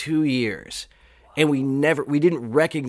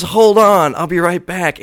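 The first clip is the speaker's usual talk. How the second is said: loud, higher-pitched retold holler; imitated shout; 7 semitones higher, 14 dB louder than usual. Storyteller replays his friend dashing to the stage, imitated shout. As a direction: call it out urgently, retelling a friend.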